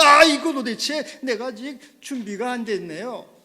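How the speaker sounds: high, loud flustered pleading; panicked plea; startled panic. Retold or imitated. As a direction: beg him to wait, caught off guard. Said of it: "깜짝 놀라는".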